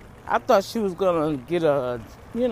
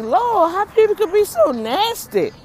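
high, disgusted exclamation; appalled, voice way up; 11 semitones higher, 3 dB louder than usual. Retold or imitated. Shopper recalling her reaction to the nasty cashier, high appalled cry. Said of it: "And I'm like".

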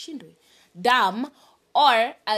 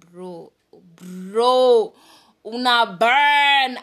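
louder, slow exasperated cry; 9 dB louder, much slower than usual. Real, not acted. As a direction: drag it out, fed up and burning. Exasperated outburst.

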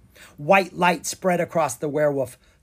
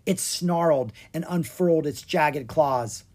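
usual speaking voice

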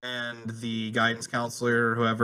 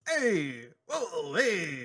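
mobster voice; 4 semitones higher, much slower than usual. Retold or imitated. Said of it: "see Bill Hader do a mobster impression".

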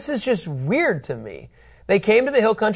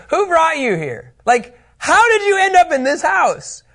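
higher, louder alarmed questioning; startled spies; 12 semitones higher, 5 dB louder than usual. Acted. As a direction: act out the startled spies confronting him. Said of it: "They say".

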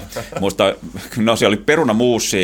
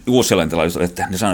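ordinary talk

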